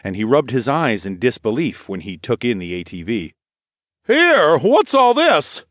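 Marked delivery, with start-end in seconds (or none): 4.1-5.6 high, loud, drawn-out challenge; demanding. A narrator's reading. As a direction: demand an answer from late arrivals. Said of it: "the man demanded".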